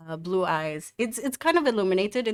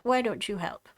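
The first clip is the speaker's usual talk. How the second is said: ordinary talk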